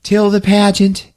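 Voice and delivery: high, slow put-on voice; halting, drawn-out phrases as a comic impression; imitated pageant contestant. [name] telling how she prepared for pageant, put-on voice. Acts out anorexic contestant's interview.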